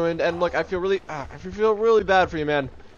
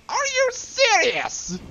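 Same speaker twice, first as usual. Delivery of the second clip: very high, drawn-out disbelief; incredulous outburst; much slower than usual. Real, not acted. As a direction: squeal it slowly in disbelief. Disbelief at the game.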